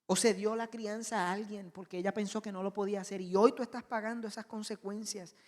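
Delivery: ordinary delivery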